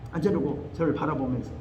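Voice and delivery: normal talking voice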